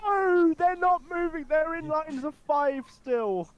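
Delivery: high, loud and frustrated; exasperated. Real, not acted. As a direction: throw up your hands in disbelief. Convoy fails to change lines.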